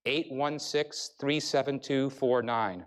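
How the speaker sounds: each digit read out slowly and evenly, spaced apart; slow, measured number reading; slow digit dictation. Shown as a demonstration. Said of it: "Repeat after me".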